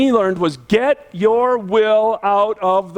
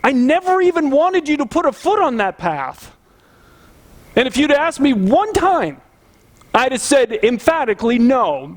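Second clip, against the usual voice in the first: high-pitched, exasperated protest; God answering back; 7 semitones higher than usual. Acted. God replying to the angry believer, exasperated and emphatic. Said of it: "he's sitting there going".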